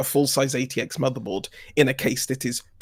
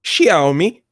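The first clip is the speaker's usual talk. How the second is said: Xiaomi echoed loud with a questioning rise; mocking inflection; 3 semitones higher, 6 dB louder than usual. Listener echoes the friend's pronunciation of Xiaomi back, mocking inflection. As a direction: exaggerate the rising tune on Xiaomi. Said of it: "with that particular inflection".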